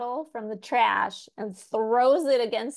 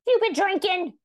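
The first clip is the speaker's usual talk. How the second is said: put-on evil drunk mom; 11 semitones higher, 5 dB louder than usual. Mom accuses son.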